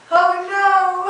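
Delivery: high, loud hammed-up "Oh no!"; mock alarm. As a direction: squeal like a dancer going down mid-move. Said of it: "after going".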